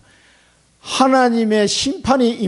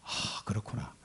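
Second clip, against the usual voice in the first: whispered realization; 7 semitones lower, 19 dB quieter, slower than usual. Acted. Realization murmured.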